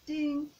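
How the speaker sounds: little bell chime; bell ding. Shown as a demonstration. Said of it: "by saying ding".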